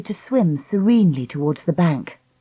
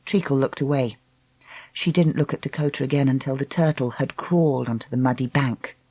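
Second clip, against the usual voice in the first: ordinary delivery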